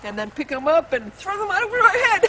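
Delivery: put-on childlike giddy leaf toss; playful. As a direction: toss the leaves with glee. Playful leaf-throwing exercise.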